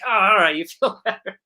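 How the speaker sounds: high, loud, amused reply with a laugh; laughing director. Retold or imitated. Director laughing back at the giggling young actor. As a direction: laugh it out in mock relief. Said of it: "he was like".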